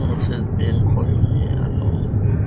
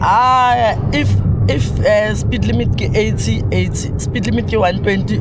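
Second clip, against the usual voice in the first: excited arguing; 7 semitones higher, 5 dB louder than usual. Real, not acted. Arguing about speed limit.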